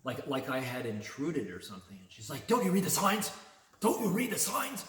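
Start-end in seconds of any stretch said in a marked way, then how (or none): 2.5-4.8 much higher and louder, scolding twice; shrill freaked-out yell. Retold or imitated. Clerk scolding a customer who ignored the sign, high frantic yell. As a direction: shriek it like an outraged clerk. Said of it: "really animated and freaked out".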